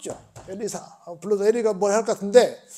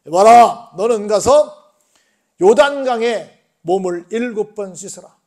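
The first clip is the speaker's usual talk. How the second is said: a sudden loud barked order; loud command; 7 dB louder than usual. Retold or imitated. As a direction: call it out like a servant giving orders. Servant relays prophet's order.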